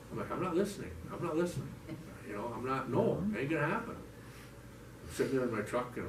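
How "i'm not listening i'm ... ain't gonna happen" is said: higher, sing-it-off denial; mock-childish digging in of heels; stubborn refusal. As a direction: block out the voice.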